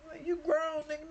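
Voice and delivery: high, pleading brother voice, mildly acted. Brother begging his sibling not to leave him, high pleading. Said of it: "but he still ain't like".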